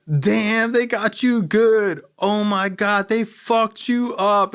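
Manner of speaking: a hint of high, slow teasing friends; lightly voiced mocking buddies; mock friends' voice. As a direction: drawl it like mates enjoying the show. Friends laughing at his injuries.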